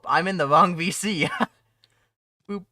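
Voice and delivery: normal talking voice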